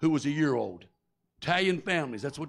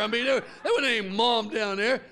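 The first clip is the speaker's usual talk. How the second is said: ordinary delivery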